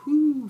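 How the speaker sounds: mock-wasted "whoo", pitched high and loud; high-pitched whoop. Mimes being high on near-beer.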